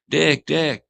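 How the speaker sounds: high, loud calling out a name; high-pitched Pee Wee voice. Retold or imitated. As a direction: call him in a thin, high voice. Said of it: "in this high pitched voice".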